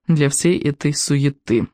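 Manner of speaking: plain reading voice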